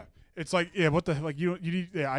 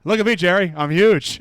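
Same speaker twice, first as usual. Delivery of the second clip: louder, boasting voice; mock Banya; 4 semitones higher, 12 dB louder than usual. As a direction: Banya bragging to Jerry, louder. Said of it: "He goes".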